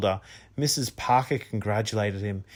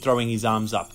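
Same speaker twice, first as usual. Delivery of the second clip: ordinary delivery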